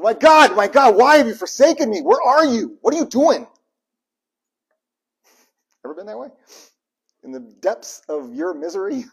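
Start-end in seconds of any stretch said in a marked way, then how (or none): 0.0-3.5 loud, high, raw anguish to God; desperate cry. Shown as a demonstration. Preacher showing the real desperate cry, loud anguish. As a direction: shout it like you mean it. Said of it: "It was".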